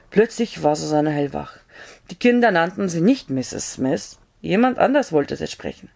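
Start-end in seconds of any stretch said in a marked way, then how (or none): none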